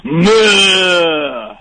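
imitating a singer's retch; a short gagging meh sound; retching groan. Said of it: "sounds like he's throwing up at first".

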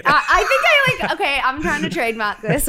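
bursting into laughter mid-sentence; giggling at her own invention; laughing. Real, not acted. Laughing at own made-up term.

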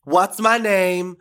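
loud, high, drawn-out star entrance; excited announcement. Real, not acted. Drag queen surprising a fan by phone, excited announcement. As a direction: announce yourself big, stretching every word.

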